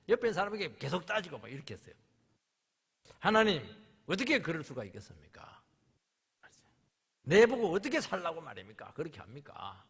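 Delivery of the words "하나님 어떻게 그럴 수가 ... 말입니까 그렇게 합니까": loud protesting plea to God; arguing with God. Retold or imitated. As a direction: demand answers from God. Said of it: "계속 따졌어요". (하나님께 따지듯 크게)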